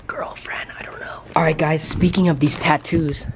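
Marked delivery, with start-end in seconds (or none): 0.1-1.2 hushed, voiceless trailing-off; whispered aside. Real, not acted. Vlogger musing to viewers about tattoo pranks, whispered aside. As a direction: whisper it as an afterthought.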